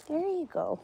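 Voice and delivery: coaxing pet voice. Real, not acted. Feeding the animal.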